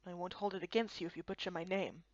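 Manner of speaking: low, soft, grave elder voice; solemn old Kappa. Acted. Old Kappa elder welcoming foreigners, low and soft. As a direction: speak like an ancient guardian of sacred lands.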